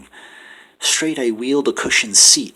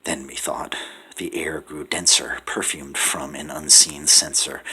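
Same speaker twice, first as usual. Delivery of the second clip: plain reading voice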